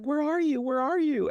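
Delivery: high-pitched calling out for someone; put-on high voice, calling into the woods; hammed-up horror heroine searching; mock searching call. Acts character searching in woods.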